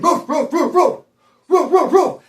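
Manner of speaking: high-pitched mimicked barks; high "roof, roof" dog impression; imitated dog barking. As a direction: bark like a dog, in sharp repeated bursts. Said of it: "I hear a dog barking".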